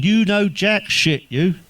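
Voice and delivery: high slow put-on wife voice; mimicking the missus. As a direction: drag it out like a nagging wife. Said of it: "she keeps saying".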